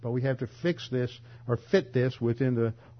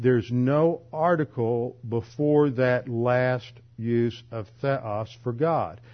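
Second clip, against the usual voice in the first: ordinary delivery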